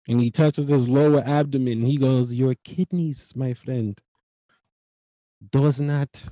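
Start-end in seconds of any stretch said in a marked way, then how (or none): 2.3-6.2 put-on measured Indian-accented warning. Clerk cautioning his customer about kidneys, imitated Indian accent.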